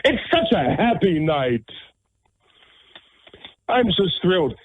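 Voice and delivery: high-pitched sarcastic delight; mock cheerful. Radio host after grim news of everybody dying, sarcastically cheerful. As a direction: feign being thrilled, voice lifted.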